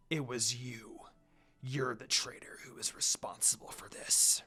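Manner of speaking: low, hissed threat; menacing whisper. Acted. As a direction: whisper it like a deadly warning. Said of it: "Sesshomaru growled furiously".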